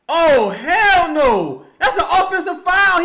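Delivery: outraged high shout; wrestling commentator. Real, not acted. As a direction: scream it at the referee. Outraged at the referee's call.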